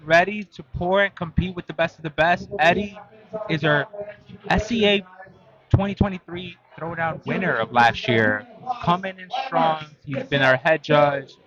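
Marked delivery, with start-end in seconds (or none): 0.0-9.9 announcer hype. Acted. Hypes the competitors like announcer.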